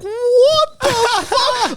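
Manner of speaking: very high, laughing squeal of shock; family reveals the chickens, shrieking in surprise. Retold or imitated. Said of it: "I'm like".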